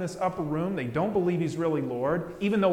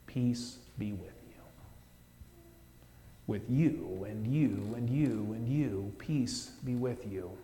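smiling whisper; 5 semitones lower, 7 dB quieter than usual. Retold or imitated. Jesus smiling at the sinner, whispered blessing. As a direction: breathe the blessing to each person. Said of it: "Jesus smiles and says".

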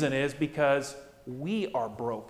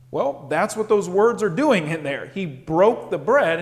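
normal talking voice

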